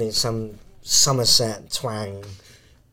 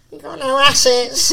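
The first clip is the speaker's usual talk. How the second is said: high, laughing schoolkid taunt; cheeky teen voice; 7 dB louder than usual. Retold or imitated. Teens mocking a teacher.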